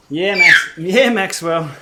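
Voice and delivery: shouting out cheerfully; loud cheer. Real, not acted. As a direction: call out his name loud. Cheering for son Max.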